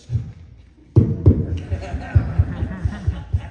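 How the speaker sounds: loud, high breathless laugh near tears; choked laugh. Real, not acted. Brother thanking Kerrville, overcome, choked laugh. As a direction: laugh as emotion takes over. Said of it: "Oh, this is ridiculous".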